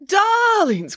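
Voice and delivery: high, gushing greeting; theatrical joyful welcome; cry of delight. Acted. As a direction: squeal it to beloved friends. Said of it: "cried Nanny Piggins with delight".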